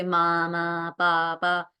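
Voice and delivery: slow, drawn-out "मामा, पापा"; demonstrated babbling. Shown as a demonstration. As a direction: repeat each syllable evenly in "मामा, पापा". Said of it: "single syllable को repeat करता रहता है". (बड़बड़ाते बच्चे जैसे धीमे "मामा, पापा")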